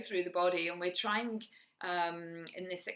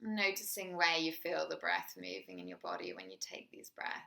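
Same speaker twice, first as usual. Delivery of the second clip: guided meditation; 4 dB quieter than usual. Acted. Teacher guiding a listener through a body scan, soft meditation voice. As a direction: ease the listener into the body, gently.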